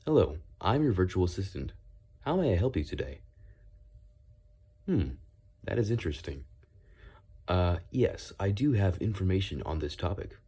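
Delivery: polite virtual assistant voice. Shown as a demonstration. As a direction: recite like a helpful AI assistant. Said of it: "more of an A I voice".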